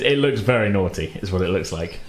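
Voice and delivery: normal talking voice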